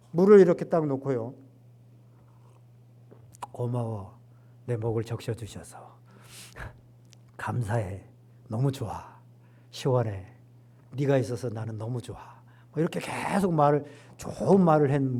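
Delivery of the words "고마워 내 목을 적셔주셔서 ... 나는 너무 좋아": soft, tender words to a glass of water; gentle thanks. Retold or imitated. Preacher voicing kind words to water, softly and tenderly. Speaking kindly to water experiment.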